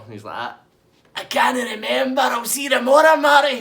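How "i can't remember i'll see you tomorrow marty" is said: high, loud shout over the shoulder; loud parting yell. Retold or imitated. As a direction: bellow it as you walk off. Gary yelling as he leaves.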